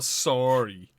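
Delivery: "sorry" drawn out loud like a Canadian, falling; loud mock Canadian "sorry". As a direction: belt out "sorry" in a hammed-up Canadian accent. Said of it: "are you Irish now?".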